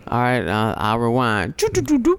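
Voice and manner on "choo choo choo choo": high creepy choo choo sounds; sleazy train noise, pitched way up. Acted. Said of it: "You sound like a creep".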